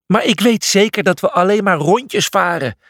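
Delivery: high, shouted protest. A narrator's reading. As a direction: yell a complaint over the storm. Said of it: "schreeuwde hij".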